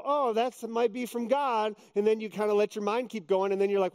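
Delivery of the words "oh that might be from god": slow musing. Retold or imitated. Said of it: "You go".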